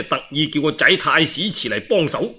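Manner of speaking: ordinary delivery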